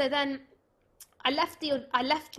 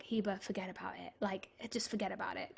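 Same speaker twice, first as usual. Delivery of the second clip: hushed advice; 3 semitones lower, 9 dB quieter than usual. Retold or imitated. Best friend consoling her.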